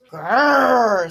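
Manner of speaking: loud growling grrr; lion growl. Acted. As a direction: growl like a cranky young lion. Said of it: "said the lion".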